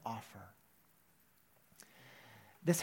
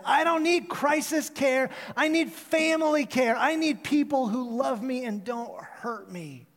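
retold shouting; 7 semitones higher, 5 dB louder than usual. Homeless woman yelling at crisis workers, high and loud. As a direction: cry it out with raw hurt. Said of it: "just screaming at these people".

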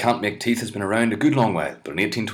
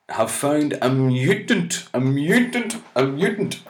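rising, higher each time on mutant; dramatic mutant; 7 semitones higher, 3 dB louder, slower than usual. Pretend. Comic reading science news, hamming up mutant. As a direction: build up the word mutant.

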